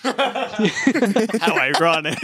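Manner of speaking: laughing. Real, not acted. Laughing aside.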